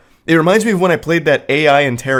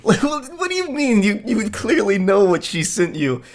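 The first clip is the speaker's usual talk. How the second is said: much higher, laughing in disbelief; incredulous laugh; 9 semitones higher than usual. Real, not acted. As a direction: crack up at how absurd it is. Laughing at the boyfriend's reply.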